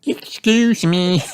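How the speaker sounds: put-on high, slow child voice; little piglet. A narrator's reading. Peppa excusing herself after a burp, little piglet voice. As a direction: draw it out in a high little-girl voice.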